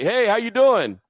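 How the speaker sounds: upbeat high coach greeting; a hyped-up coach saying hi; 1 imitating Coach Gruden. Gruden greets from car window.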